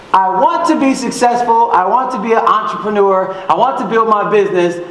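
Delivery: ordinary talk, nothing marked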